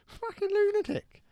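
shrieking outraged bystander; high shocked voice. Pretend. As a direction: screech it high in disgust.

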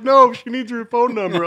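high, loud retold exasperation; exasperated correction. Friends correcting Sam at the checkout, loud exasperation. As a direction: set him straight loudly.

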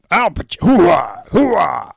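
loud slow barked hooah; Al Pacino hooah. Retold or imitated. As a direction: bellow hooah, gung-ho soldier. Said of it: "Al Pacino here".

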